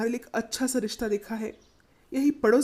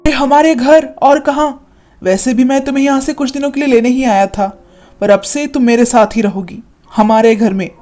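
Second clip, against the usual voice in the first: firm loud reassurance; 8 dB louder than usual. Acted. Brother takes sister home.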